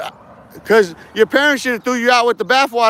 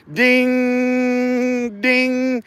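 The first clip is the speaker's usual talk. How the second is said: higher, sung-out ding like a little bell; 4 semitones higher than usual. Retold or imitated. Mocking the trolls by chiming like a bell. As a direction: ring it out like a doorbell. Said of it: "That sound like a northern bell ringing".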